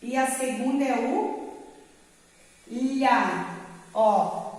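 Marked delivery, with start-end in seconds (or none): 0.0-3.5 "fi" then pause then "lha"; teacher splitting a word; split syllables. Shown as a demonstration. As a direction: break "filha" into two slow syllables. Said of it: "vou separar ela assim".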